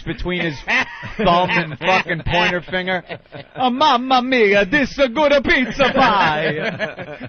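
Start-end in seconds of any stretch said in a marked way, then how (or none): 3.5-7.3 loud, slow hammy Italian chef; jolly italian pizza chef. Retold or imitated. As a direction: ham up a cartoon pizza chef. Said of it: "guy wearing the big hat".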